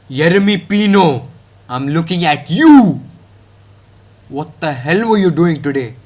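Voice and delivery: ordinary talk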